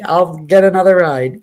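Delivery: "I'll get another ride" blurted out loud; mocking her huff, said loud; mock huffy outburst. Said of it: "been like".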